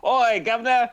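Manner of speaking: high, loud hammed-up English greeting; English accent. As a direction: call out in mock English accent. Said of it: "do an English accent".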